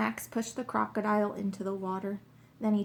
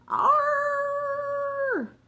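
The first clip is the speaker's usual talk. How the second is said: long, high held "arr" cry; loud roar; 7 dB louder than usual. A narrator's reading. Unseen beast startles the walkers, loud drawn-out roar. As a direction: let out a loud, drawn-out creature call. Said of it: "they heard a loud sound".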